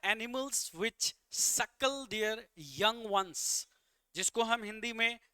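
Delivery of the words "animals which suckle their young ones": slow reading of the question text; slowly reading the quiz question; slow read-aloud. Shown as a demonstration. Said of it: "कह रहा है".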